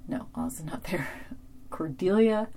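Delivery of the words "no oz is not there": whispered aside to herself; whispered correction. Real, not acted. Recapper whispering a correction to herself about Oz. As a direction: whisper the fix to yourself.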